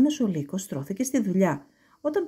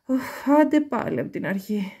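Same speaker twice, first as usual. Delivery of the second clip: weary sigh; 4 semitones higher than usual. A narrator's reading. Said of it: "Ο Λύκος αναστέναξε".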